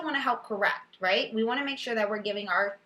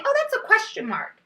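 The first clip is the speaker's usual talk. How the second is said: put-on child's answer; 12 semitones higher, 6 dB louder than usual. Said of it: "They might say".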